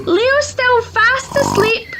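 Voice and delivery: Ma Lion, voiced high and soothing; high motherly lioness reassuring. A narrator's reading. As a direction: lift into a gentle mother's voice.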